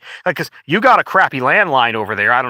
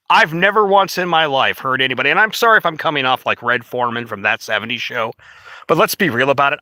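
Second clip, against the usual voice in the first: ordinary talk, nothing marked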